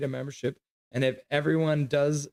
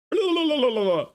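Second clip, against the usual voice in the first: sudden high burst of laughter; 13 semitones higher, 4 dB louder than usual. Real, not acted. Laughing at chat.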